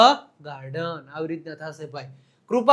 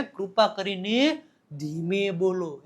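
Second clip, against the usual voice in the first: slow, softer and stretched out; slow demonstration; 4 semitones lower, 6 dB quieter, slower than usual. Shown as a demonstration. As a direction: draw each word out gently to show slow speech. Slowly demonstrates "speak slowly. (ધીમેથી, લંબાવીને બોલવું)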